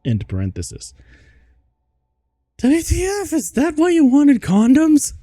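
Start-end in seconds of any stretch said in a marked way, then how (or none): 2.6-5.1 very high, loud screechy demand; hammed-up high-pitched teen screech; sadistic question. A narrator's reading. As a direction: squeal it out mockingly, way up high. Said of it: "I asked sadistically".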